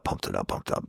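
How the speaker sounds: breathy whispered repeat, trailing away; mocking whisper echoing the hype. Shown as a demonstration. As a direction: let the repeat fade to a whisper. Repeating a phrase, fading.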